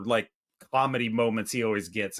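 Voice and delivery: ordinary delivery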